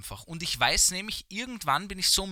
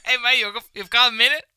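street fundraiser; 7 semitones higher, 9 dB louder than usual. Retold or imitated.

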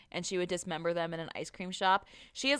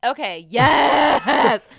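loud, drawn-out yes; excited shout; 6 semitones higher, 15 dB louder, much slower than usual. Real, not acted. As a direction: shout it with delight.

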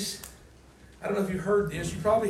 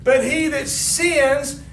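loud, high, with a shout in it; fiery sermon climax; full-force preaching; 6 semitones higher, 7 dB louder than usual. Acted. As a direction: preach it to the rafters.

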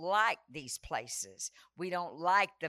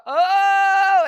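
1 mock frightened gasp; 12 semitones higher, 19 dB louder than usual. Imitating mom scared of heights.

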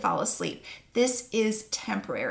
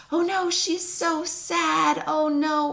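higher, drawn-out mock dismay; worried parent; 6 semitones higher, slower than usual. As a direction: fret like an anxious parent, pitched up. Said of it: "we hear our child cry and we think".

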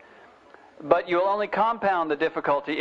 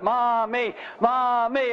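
imitated kids' singsong yell, higher; children yelling; 6 semitones higher, much slower than usual. Kids in the bath calling for their mother, imitated yelling. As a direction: mimic children calling in drawn-out syllables. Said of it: "they were yelling in the back".

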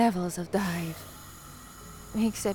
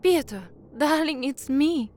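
higher, louder eager hello; excited greeting; 5 semitones higher, 6 dB louder than usual. Acted. Woman calling her partner after her broadcast, excited louder greeting. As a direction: burst in gladly to your love.

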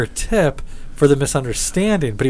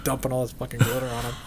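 ordinary talk, nothing marked

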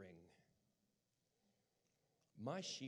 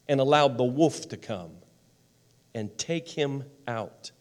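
projected sermon delivery, deliberate pauses; big, emphatic pulpit voice; impassioned preaching; 23 dB louder, slower than usual. Real, not acted. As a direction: preach it out loud, phrase by weighty phrase.

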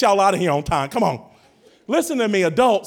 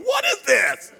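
mock disbelief; 11 semitones higher, slower than usual. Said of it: "I was like".

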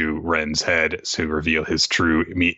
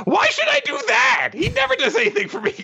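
very high squeaky mock outrage; hammed-up high-pitched indignation; indignant. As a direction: protest in a piercing put-on cartoon voice. Indignant character quote in recap.

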